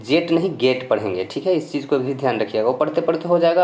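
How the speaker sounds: ordinary talk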